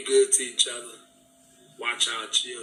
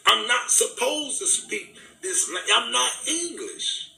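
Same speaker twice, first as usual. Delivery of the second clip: high-pitched, impassioned protest; indignant outburst; 6 semitones higher than usual. Real, not acted. Speaker defending their own accent to listeners, indignant and high-pitched. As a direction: protest it heatedly, pitch pushed well up.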